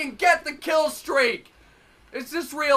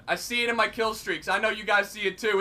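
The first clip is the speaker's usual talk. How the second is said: normal talking voice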